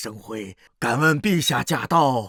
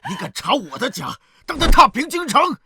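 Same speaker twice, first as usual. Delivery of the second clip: high, raised voice building to a shout; furious threat; 12 semitones higher, 5 dB louder than usual. Acted. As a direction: rage at the one raiding your house. Furious threats during raid. (暴怒地高声威胁)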